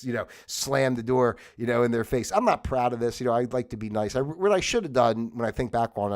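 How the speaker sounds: ordinary talk, nothing marked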